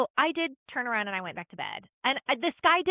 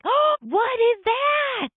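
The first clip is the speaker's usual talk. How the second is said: much higher, slow and loud "Oh, what is that?"; amazed; 13 semitones higher, 5 dB louder, much slower than usual. Retold or imitated. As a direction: stretch it out in high astonishment. Seeing the photo, amazed.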